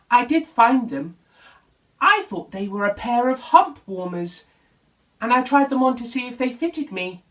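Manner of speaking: friendly camel owning up cheerfully; Camel, lightly voiced.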